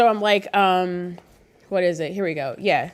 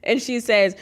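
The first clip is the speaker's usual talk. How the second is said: ordinary talk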